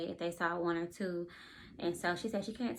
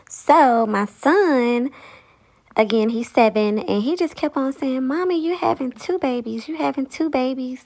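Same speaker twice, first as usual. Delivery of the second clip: excited; 3 semitones higher, 12 dB louder than usual. Real, not acted. Loud excited ending.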